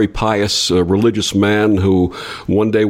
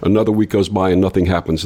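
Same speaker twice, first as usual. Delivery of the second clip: usual speaking voice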